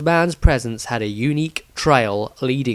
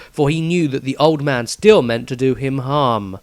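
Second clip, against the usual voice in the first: ordinary delivery, nothing marked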